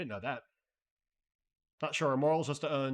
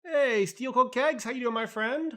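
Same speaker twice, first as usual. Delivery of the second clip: excited greeting; 10 semitones higher, 6 dB louder than usual. Real, not acted. Greeting a chat viewer.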